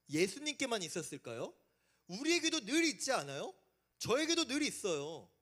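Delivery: ordinary talk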